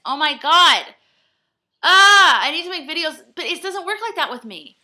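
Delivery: a real overwhelmed outburst; frantic exasperation. Real, not acted. Vlogger panicking about a seventeen-minute video, frantic and loud.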